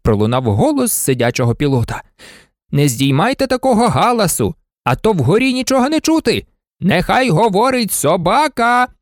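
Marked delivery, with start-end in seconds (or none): 2.7-8.9 high, raised protest over a din; calling out. Acted. Pilot calls down over the din.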